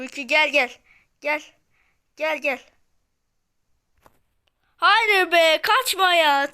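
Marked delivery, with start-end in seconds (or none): 4.8-6.5 high, loud protest as the enemy escapes; whining shout of frustration; frustrated shout. Real, not acted. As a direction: yell at him not to run.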